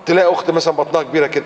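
ordinary delivery

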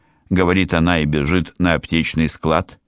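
ordinary delivery, nothing marked

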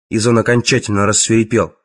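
plain reading voice

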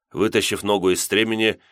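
ordinary delivery, nothing marked

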